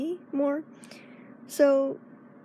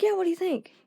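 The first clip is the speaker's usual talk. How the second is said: higher eager friend; friend asking eagerly; 7 semitones higher than usual. Retold or imitated. Friend wanting praise for nails, higher and eager. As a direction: ask eagerly for approval. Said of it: "She was like".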